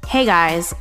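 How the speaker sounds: normal talking voice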